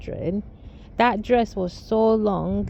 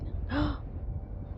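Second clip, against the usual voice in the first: sharp, breathy imitated gasp; toddler's gasp; 6 semitones higher, 12 dB quieter than usual. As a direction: gasp like a startled little child. Toddler gasps seeing mom.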